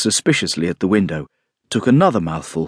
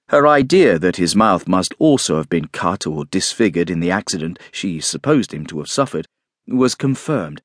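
plain reading voice

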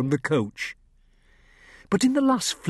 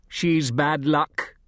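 slow, grave warning; lightly voiced wary gentleman; ominous gentleman; 3 semitones higher, slower than usual. A narrator's reading. Larson's voice in story.

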